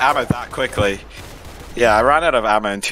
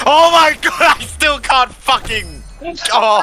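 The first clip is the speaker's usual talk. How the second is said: high, loud, drawn-out rage scream; raging gamer; 4 dB louder, slower than usual. Real, not acted. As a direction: scream out slowly at losing. Loses to golden gun.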